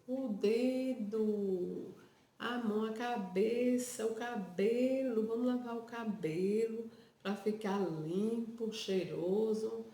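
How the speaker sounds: put-on slow, stretched singsong, as to a baby; fala com bebê. Shown as a demonstration. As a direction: draw out each body part in motherly baby-talk. Encena banho falando com bebê. (falando com bebê, cantado e devagar)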